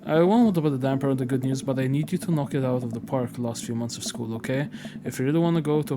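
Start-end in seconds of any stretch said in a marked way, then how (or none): none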